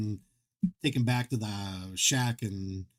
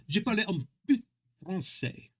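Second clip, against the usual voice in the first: schoolbook French, said a little higher; lightly higher French line; French phrase; 6 semitones higher than usual. Shown as a demonstration. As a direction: show off your high-school French accent. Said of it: "I barely speak French".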